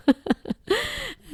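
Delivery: a sudden high burst of laughter. Real, not acted. Mother cracking up at her son's hot tub plan, high laugh. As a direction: let a high laugh break out. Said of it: "And so he was laughing".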